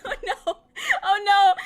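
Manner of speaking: loud, slow laughing oh no. Real, not acted. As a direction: laugh in mortified panic. Embarrassed realization.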